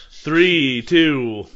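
loud count, three then two; loud countdown. Shown as a demonstration. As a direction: call the countdown firmly. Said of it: "I'm saying three".

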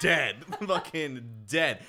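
slow, laughing through the words. Real, not acted. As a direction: drag it out while laughing. Laughing about the dog.

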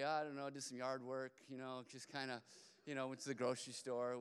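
low, offhand small talk; nonchalant. Acted. Man recounting an ordinary day, nonchalant. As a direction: shrug it off quietly.

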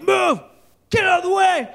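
high, loud furious yell; angry shout. Retold or imitated. Enraged driver yelling at him to move, furious yell. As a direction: bark it at him. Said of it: "and he's like".

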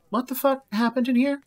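annoyed on walking in; irritated. Acted. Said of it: "Kylan wanders back in".

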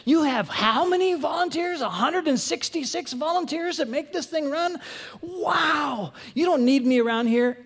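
laughing disbelief. Retold or imitated. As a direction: laugh it off, then exclaim in disbelief. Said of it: "And I'm thinking".

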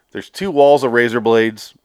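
ordinary delivery

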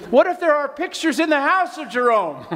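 amused. Real, not acted. Playful rising joke.

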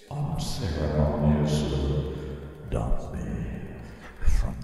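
slow, low echoing delivery; digital echo. Shown as a demonstration. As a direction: draw it out deep and slow. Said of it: "Give me a little bit of echo".

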